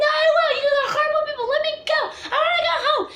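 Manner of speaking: hammed-up tantrum, squealing to be released; mock crying and yelling. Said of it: "yelling, screaming".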